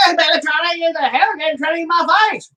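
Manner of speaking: hammed-up high hillbilly imitation; mock hillbilly accent. Husband mocking wife's hillbilly accent, high and hammy. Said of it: "Why did you suddenly turn into a hillbilly?".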